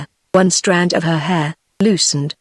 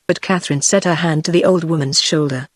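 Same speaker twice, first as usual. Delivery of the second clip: ordinary delivery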